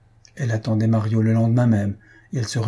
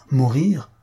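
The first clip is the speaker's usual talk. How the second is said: louder, strangely intent repeat; strange accent of emotion; 3 semitones higher, 3 dB louder than usual. A narrator's reading. Suitor repeats her word as he rises, strangely intent. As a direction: repeat it with odd, heightened emphasis. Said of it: "avec un accent étrange".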